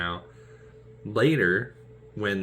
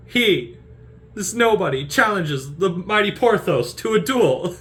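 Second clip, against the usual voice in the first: higher, louder, slow mockery; scoffing Porthos; 11 semitones higher, 7 dB louder than usual. Porthos mocking the challenge to a duel, higher. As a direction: scoff to the crowd.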